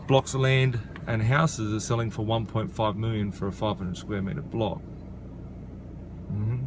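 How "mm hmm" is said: usual speaking voice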